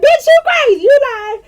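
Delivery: high, loud, cracking up through the words; laughing disbelief. Retold or imitated. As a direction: shriek it while laughing at the screen. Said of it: "And I was like".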